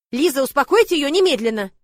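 shout. Acted. Lord yelling at Liza to calm the dragon, loud. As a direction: yell from outside the door. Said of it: "закричал".

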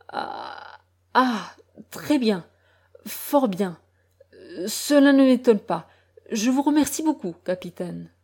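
father who has just recognised his brother answers the captain, choked; choked, shaken. A narrator's reading. A voice pushed out through a tight throat. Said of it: "la gorge serrée, les yeux hagards".

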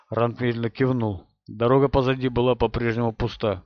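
usual speaking voice

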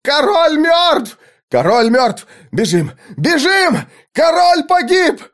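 very high, far-carrying wail; panicked cries. A narrator's reading. Fleeing soldiers spread word of the king's death, panicked cries. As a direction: cry out high, stretching the words.

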